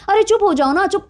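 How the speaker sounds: sharp high hush at the husband; angrily telling him to shut up; angry hush. Retold or imitated. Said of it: "चुप कराने के लिए sorry". (पति को चुप कराती, गुस्से में ऊँची आवाज़)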